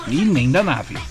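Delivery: ordinary delivery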